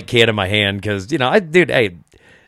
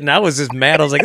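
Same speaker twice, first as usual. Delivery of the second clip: ordinary talk, nothing marked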